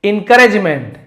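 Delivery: Encouragement called out high and loud; teacher's loud model of encouragement; loud model word. Shown as a demonstration. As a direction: hit encouragement loudly, falling at the end. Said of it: "encouragement उत्साह देना".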